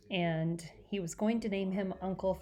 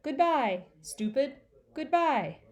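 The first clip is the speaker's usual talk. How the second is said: high, louder squawky goodbye; bird calling out; 5 semitones higher, 4 dB louder, slower than usual. Acted. Mynah bird calling after Peter as he leaves. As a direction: call out like a cheeky mynah. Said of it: "Uncle Feather called out".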